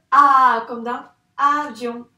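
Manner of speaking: "A" long and very loud, echoed in "avion"; sound demonstration. Shown as a demonstration. Teacher answers which letter is missing, sounded out loudly. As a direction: stretch the "A" alone and at the start of "avion". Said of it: "Quelle lettre il nous manque?".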